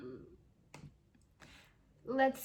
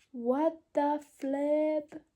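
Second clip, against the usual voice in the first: amazed; 4 semitones higher, 5 dB louder, much slower than usual. Real, not acted. Disbelief at finishing.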